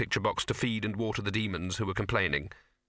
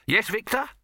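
brisk producer; 12 semitones higher, 4 dB louder than usual. Acted. Dibbler voiced.

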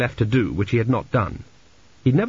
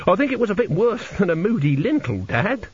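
higher-pitched, lilting son's voice; Two B, the son; 9 semitones higher than usual. Acted. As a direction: voice a son correcting his dad, pitched up.

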